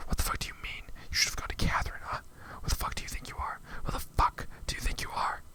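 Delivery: furious whisper. Real, not acted. Furious rant at the game character.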